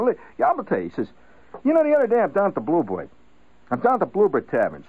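father recounting his tavern tale to the wife; father telling a story. Retold or imitated.

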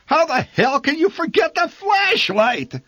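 high, loud outraged yell; furious father. A narrator's reading. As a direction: blast her for forgetting it. Father yelling at mother.

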